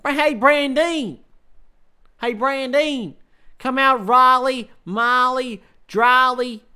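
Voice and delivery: high, loud cartoon hillbilly voice; Cletus. Streamer showing off his Simpsons accent, exaggerated hillbilly holler. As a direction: holler it slowly like a cartoon yokel. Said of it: "I can do Cletus".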